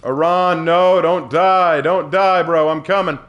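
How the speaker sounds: urgent calling out. Pretend. Player calls to a dying teammate, urgent shout. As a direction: shout out that help is on the way.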